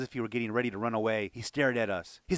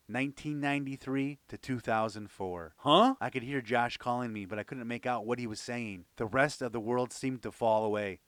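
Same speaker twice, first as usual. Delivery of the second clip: ordinary delivery, nothing marked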